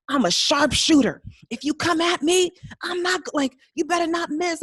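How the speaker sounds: loud, high-pitched bragging; hyping herself up. Retold or imitated. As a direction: imitate a cocky pep talk at full volume. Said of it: "she's hyping herself up".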